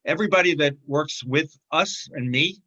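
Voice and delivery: normal talking voice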